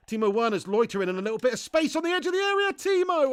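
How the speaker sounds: loud, high commentary rising to a drawn cry; building commentary excitement. Real, not acted. Streamer urging Timo at the edge of the area, excited. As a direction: build urgently to a high shout of the name. Live attack builds to a chance.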